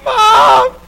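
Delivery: put-on high, loud child's wail; a sobbing child's call for mom; crying out for mom. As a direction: cry out like a scared kid. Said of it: "I'm like".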